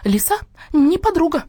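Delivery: high squawked slogan; hen crying out. A narrator's reading. Hen in the chorus denounces the fox, crying out high. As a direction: cry it out, pitched far up. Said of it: "уставился на орущую курицу".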